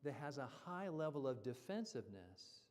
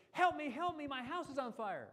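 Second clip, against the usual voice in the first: high, loud, frantic cry for help; panicked homeowner; 9 semitones higher, 7 dB louder than usual. Acted. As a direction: beg for help as the house burns. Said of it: "the owner comes out and says".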